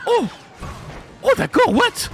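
a real surprised yelp; oh, d'accord, what? squeaked up in surprise; surprised high exclamation. Real, not acted. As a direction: jump up high, startled. Surprised by a game event.

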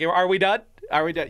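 a hint of an impatient pup; voicing the dog. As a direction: speak for the dog, a bit pushy. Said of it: "He's like".

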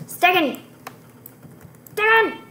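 loud, high shout of the name Stegen; yelling a name. Real, not acted. Gamer shouting at teammate Stegen, loud alarmed yell. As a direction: call out Stegen sharply at the top of the voice.

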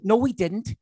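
scolding a friend who called back; mock stern scolding.

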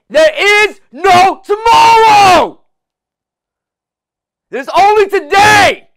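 slow, drawn-out full-power shout; motivational shout. Real, not acted. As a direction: yell it to wake them up. Motivational speech peak.

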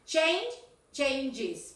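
"change", then "changes" with an extra syllable; added ES ending. Shown as a demonstration. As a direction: set "change" against "changes". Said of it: "porque es con E S".

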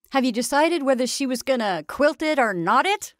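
loud, high, jovial questioning; teasing pleasantry. A narrator's reading. Attorney makes small talk with the ladies, teasing. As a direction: ask it with a rising, patronizing lilt. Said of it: "as one turning from serious things to little pleasantries".

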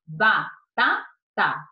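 BATATA split slowly into ba, ta, ta; slow, separated beats on Batata; syllable by syllable. Shown as a demonstration. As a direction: count out each syllable of Batata with pauses. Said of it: "Vamos lá?".

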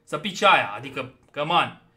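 high, loud exasperated reasoning; indignant arguing. Real, not acted. Player disputing the drop chances, loud indignant arguing.

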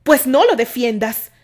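loud, slow retort; shouting. A narrator's reading. Candy shouts at Terry, slow and loud. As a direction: fire it back at her. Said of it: "Candy también gritó".

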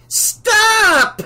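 very high, loud drawn-out shriek. A narrator's reading. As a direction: scream it to halt the singers. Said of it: "she shrieked".

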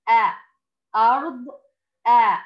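أ and أرض called out loud; model reading. Shown as a demonstration. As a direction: sound out أ then read أرض. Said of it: "كيف ينطق؟". (نطق أرض بوضوح)

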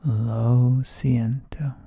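lo siento very slow and loud, stress on lo; slow loud demo. Shown as a demonstration. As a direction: have the class repeat lo siento slowly, stress on lo. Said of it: "Say it with me all".

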